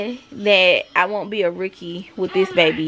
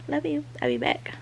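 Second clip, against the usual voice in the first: soft sign-off; 8 semitones higher, 9 dB quieter than usual. Real, not acted. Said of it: "signing off softly".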